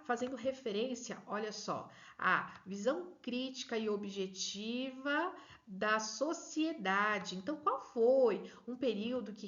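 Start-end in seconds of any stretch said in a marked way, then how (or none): 2.2-7.3 slow emphasis. Shown as a demonstration. Teacher emphasizing key quote.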